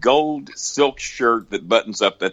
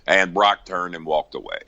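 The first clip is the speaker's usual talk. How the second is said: normal talking voice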